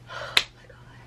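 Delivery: voiceless, amazed whisper; whispered awe. Retold or imitated. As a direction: whisper it in sheer relief. Relieved awe at being rescued.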